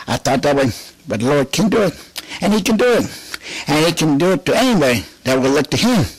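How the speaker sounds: ordinary talk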